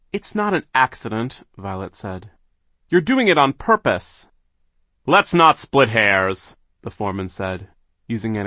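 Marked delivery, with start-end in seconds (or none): 0.1-1.5 indignant. A narrator's reading. Violet confronts the villain. 2.9-4.3 high, loud accusing; indignant accusation. A narrator's reading. Violet confronts the villain. 5.1-6.6 slow, loud, drawn-out dismissal; dismissive villain. A narrator's reading. Villain foreman dismisses Violet.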